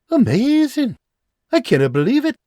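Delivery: thrilled high Scottish exclamation; overjoyed cry; excited. Acted. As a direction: burst out with delight. John reads good news.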